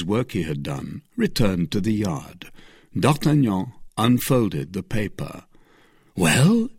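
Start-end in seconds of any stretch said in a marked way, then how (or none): 6.2-6.7 higher, louder, expectant question; eager demand. Acted. Three friends demanding the paper's word, eager. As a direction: press eagerly for the news. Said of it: "eagerly demanded".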